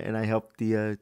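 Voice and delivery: usual speaking voice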